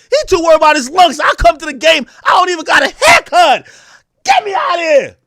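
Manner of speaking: high, loud shouted trade demand; hammed-up yelling player complaint; mock shouting rant. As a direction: yell like a furious star leaving the team.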